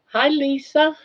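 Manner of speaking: high, cooing pet voice. Real, not acted. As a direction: owner cooing at her pet, high and tender.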